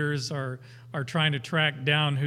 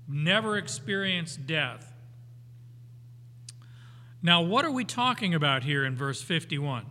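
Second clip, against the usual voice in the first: ordinary talk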